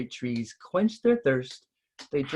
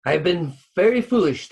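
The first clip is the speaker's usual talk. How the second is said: higher, louder remorseful cry; cried out; 7 semitones higher, 7 dB louder than usual. A narrator's reading. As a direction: cry out in regret. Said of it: "the spirit of the pond cried".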